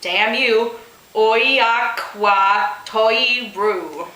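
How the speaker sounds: ordinary talk, nothing marked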